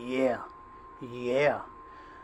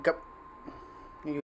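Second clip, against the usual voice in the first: ordinary talk, nothing marked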